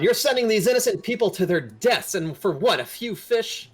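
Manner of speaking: high, loud outraged protest; yelled accusation. Acted. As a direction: keep shouting the accusation at full voice. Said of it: "they go on".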